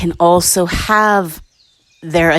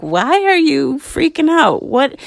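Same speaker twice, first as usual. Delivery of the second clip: puzzled surprise; 9 semitones higher than usual. Retold or imitated. Answering panicked sister.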